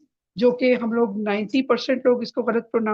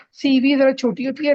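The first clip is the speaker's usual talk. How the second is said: ordinary talk, nothing marked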